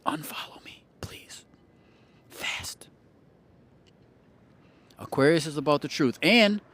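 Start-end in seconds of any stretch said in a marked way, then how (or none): 0.1-2.9 hushed unvoiced whisper. Host whispers to viewers to unfollow him. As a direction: whisper it like a secret warning.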